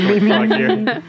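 laughing puppet frog impression; jokey frog announcer; Kermit the Frog. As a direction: do a light comic frog voice. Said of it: "I could do a nice Kermit the Frog".

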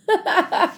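high, light laugh; short burst of laughter. Real, not acted. Woman amused by her own phone habit, laughing.

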